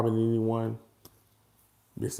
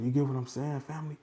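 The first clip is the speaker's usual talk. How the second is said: ordinary delivery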